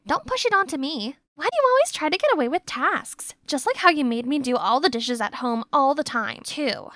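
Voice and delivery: ordinary delivery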